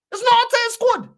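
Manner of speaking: high, loud mocking parrot of his words; mocking impression. Fan mocking a rival pundit's claim, high and sneering. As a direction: throw his words back in a squeaky sneer.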